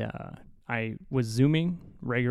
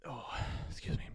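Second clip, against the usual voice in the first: whispered aside; 14 dB quieter than usual. Real, not acted. Quiet aside off mic.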